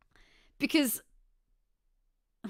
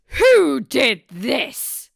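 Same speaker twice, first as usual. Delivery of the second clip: loud, very slow outraged demand; mock outrage; 3 semitones higher, 12 dB louder, much slower than usual. Streamer demanding who caged the crab, mock outrage. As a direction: stretch each word out loudly, outraged.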